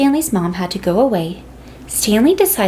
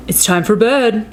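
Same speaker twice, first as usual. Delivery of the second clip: dad voice. A narrator's reading. Dad at bedtime.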